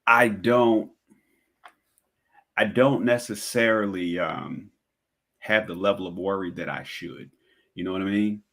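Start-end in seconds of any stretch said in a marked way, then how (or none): none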